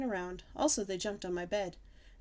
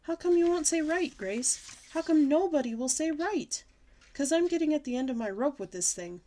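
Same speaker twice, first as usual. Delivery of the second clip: high, loud, exasperated demanding; fed-up whining; 7 semitones higher, 5 dB louder than usual. Acted. Girl shakes her friend for an answer, fed up. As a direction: at the end of her rope.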